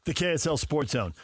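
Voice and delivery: upbeat radio announcer read; bright on-air station call. Acted. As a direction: punch out the station ID like a jingle.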